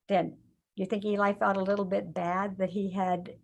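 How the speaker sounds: usual speaking voice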